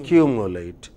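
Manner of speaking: "Accumulate" with a strong CU; stress on CU. Shown as a demonstration. Teacher confirming the class's answer, stress on CU. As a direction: stress the second syllable of "Accumulate". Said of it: "two syllables before A T E".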